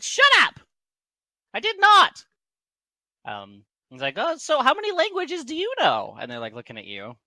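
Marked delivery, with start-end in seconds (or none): 0.0-2.2 high, loud halfling protest; indignant denial. Acted. Teased halfling snaps back in quarrel. 4.2-6.2 high, piping, louder voice; halfling questioning. Acted.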